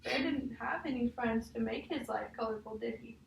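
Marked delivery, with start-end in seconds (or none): none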